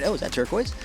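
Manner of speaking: ordinary delivery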